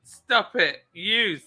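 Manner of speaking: slow, high, hammed-up scolding; mock coy protest. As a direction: play scandalized and flattered, drawn out. Mock coy fending off admirers.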